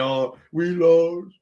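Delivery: high, slow, whiny fake sob, rising to a wail; mock crying whine. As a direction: imitate a player crying over a loss. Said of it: "I don't need him crying and saying".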